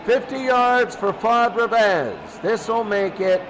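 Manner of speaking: normal talking voice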